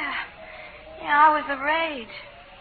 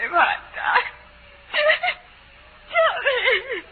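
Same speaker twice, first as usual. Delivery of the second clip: tearful pleading 1; 8 semitones higher, 6 dB louder than usual. Acted.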